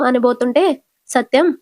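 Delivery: ordinary delivery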